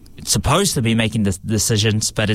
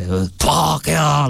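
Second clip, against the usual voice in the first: a slower, drawn-out Pākehā, lightly voiced as an old man; grandfather's way of saying it; 4 semitones higher, slower than usual. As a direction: hold the word Pākehā long like his grandfather does. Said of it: "that's how he says it".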